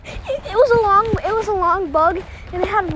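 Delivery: high and loud, rattled; high, agitated disgust. Real, not acted. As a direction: blurt it out, still rattled. High agitated after bug.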